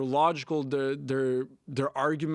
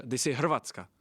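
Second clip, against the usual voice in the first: usual speaking voice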